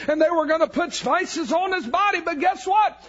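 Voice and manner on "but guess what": preaching at full force. Acted. Preacher to congregation, triumphant and high at full force. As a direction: ring out the empty tomb.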